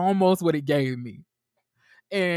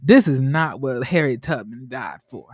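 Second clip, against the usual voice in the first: mock scandalized groan, pitched lower; exasperated church-lady reaction; 5 semitones lower than usual. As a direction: shake your head like an old auntie clutching her pearls. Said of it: "And I was like".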